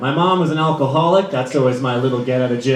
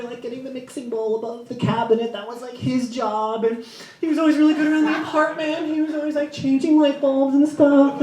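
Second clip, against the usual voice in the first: heartbroken friend; 10 semitones higher, 4 dB quieter than usual. Retold or imitated. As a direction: pour out the breakup woes. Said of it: "She's like".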